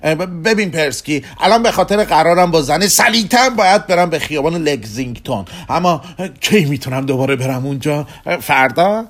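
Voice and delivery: loud, high, stammering start; urgent pleading. Acted. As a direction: rush in eagerly to book the next trip. Rushed Kugelmass begs another trip.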